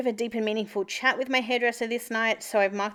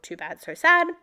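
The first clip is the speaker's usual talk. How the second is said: louder, sing-song mock of a boss; mocking dismissive; 4 semitones higher, 8 dB louder than usual. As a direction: toss it off like a smug brush-off. Boss's dismissive words retold.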